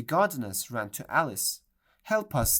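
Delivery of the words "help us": high, loud plea; cried out for help. A narrator's reading. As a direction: beg for rescue in panic. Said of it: "they cried".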